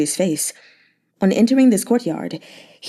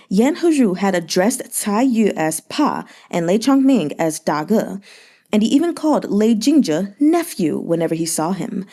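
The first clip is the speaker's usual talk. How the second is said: usual speaking voice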